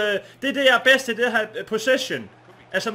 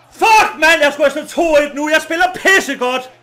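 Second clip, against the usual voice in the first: loud, raised-pitch outburst; frustrated shout; 7 semitones higher, 10 dB louder than usual. Real, not acted. Gamer who missed a goal, frustrated shouting. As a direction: yell in real exasperation at the game.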